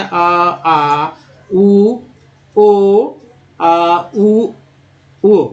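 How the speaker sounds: teacher belting out each vowel; vowel sounds demo. Shown as a demonstration. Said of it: "double ओ को दोस्तों पांच तरह से पढ़ते हैं".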